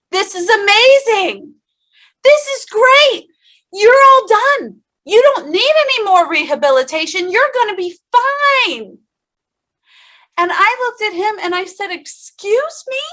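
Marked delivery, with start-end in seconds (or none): 0.1-9.0 much higher and louder, gushing with joy; excited doctor. Retold or imitated. Doctor cheering patient that rehab is over, gushing. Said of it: "he was excited". 11.9-13.0 much higher, slow, drawn-out disbelief; stunned disbelief. Retold or imitated. Patient to doctor after bad news, stunned disbelief.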